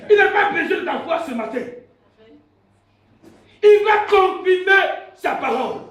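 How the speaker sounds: loud, high-pitched sermon cry; full-force preaching. Real, not acted. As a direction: proclaim it to the whole church. (prédication enflammée, criée)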